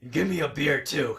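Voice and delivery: growled bar order; Batman. Acted. Ordering a beer from the server, Batman growl. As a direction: growl it, loud at the start. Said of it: "in your Batman voice".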